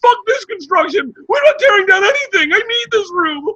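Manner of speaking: loud, very high exaggerated wail; mock sobbing. Father breaking down over daughter's room, mock sobbing. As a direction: bawl every word at full pitch, hammed up. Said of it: "sobbing, crying".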